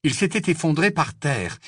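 ordinary delivery

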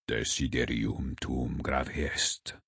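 low, slow, very soft murmur; hushed, deliberate low voice. A narrator's reading. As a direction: murmur it gravely in Latin. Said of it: "murmura le cardinal Lourdusami".

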